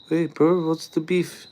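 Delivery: teasing a New Zealand viewer in a put-on New Zealand accent; mock Kiwi accent. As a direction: play a jokey Kiwi bloke greeting a mate.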